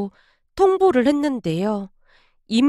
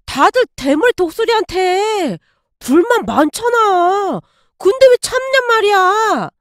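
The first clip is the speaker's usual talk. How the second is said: retold drunk yelling; 5 semitones higher than usual. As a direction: bellow it like a drunk employee fed up with the boss. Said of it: "고래고래 소리를 지르다가는".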